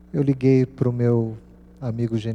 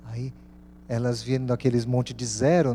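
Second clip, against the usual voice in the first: ordinary delivery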